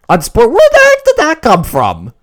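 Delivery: high, loud shout of disbelief; incredulous yell. Real, not acted. Fan startled by a sudden play, incredulous yell. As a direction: yell at the TV, stunned.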